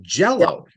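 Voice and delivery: "Jell-O" with a high, punched "Jell"; stress on first syllable. Shown as a demonstration. Teacher repeats the example, "Jell-O" stressed on "Jell". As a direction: hit "Jell" high in "Jell-O", then fall away. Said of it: "The emphasis is on the first syllable".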